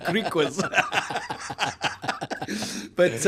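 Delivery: real laughter breaking up the words; laughing while talking. Real, not acted. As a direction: barely get it out for laughing. Both cracking up at the joke.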